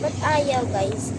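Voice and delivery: usual speaking voice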